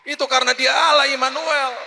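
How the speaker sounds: Allah Immanuel called out high and loud; proclaimed loud. Shown as a demonstration. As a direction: shout the second time with force. (seruan lantang, tekanan pada Allah)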